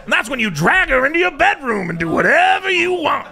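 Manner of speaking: high, exaggerated celebrity impression; hammed-up put-on voice, lecturing other men; Bill Cosby. Comic plays the entertainer as dating-show teacher, high mocking impression. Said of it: "he would teach other guys".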